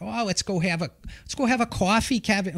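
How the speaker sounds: high, chirpy Massachusetts mom inviting to coffee; Massachusetts mom's "let's go have a coffee" in a Boston accent. Retold or imitated. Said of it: "my mom's got one".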